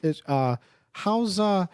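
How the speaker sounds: lightly voiced nervous dad; halting, awkward father talking to kids. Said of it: "I like how you do my dad's voice".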